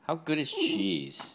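drawn-out high coo to the dog; sweet high dog-talk. Real, not acted. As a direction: sing it up high to a pet. Talking to dog, high slow.

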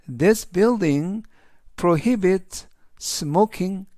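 slow, careful English sentence for learners; slow model sentence. Shown as a demonstration. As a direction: read it slowly so students can follow. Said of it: "전체적인 의미는". (학생들을 위해 천천히 또박또박 읽는 영어 문장)

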